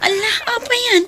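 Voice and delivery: frantic fear for her father; alarmed crying. Acted. Sister alarmed at father's pain. (nabigla nga agsangsangit)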